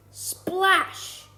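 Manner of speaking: high, loud burst on one word; dramatic splash. A narrator's reading. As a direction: make it sound like a big crash into water. Story sound word acted out.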